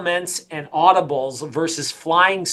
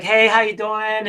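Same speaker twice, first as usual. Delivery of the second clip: higher, slower greeting of a former team member; Kenny Moore's voice; 5 semitones higher than usual. Retold or imitated. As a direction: imitate his high-pitched, unhurried phone greeting. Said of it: "this is what his tone of voice was, kinda high pitched".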